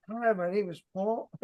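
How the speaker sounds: soft, feeble mock introduction; small, weak voice at the door; no projection. Shown as a demonstration. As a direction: imitate a timid rep who can't project. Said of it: "you have to have good projection in your voice".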